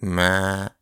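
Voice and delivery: gentle bleat. A narrator's reading. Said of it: "sanoi vienosti".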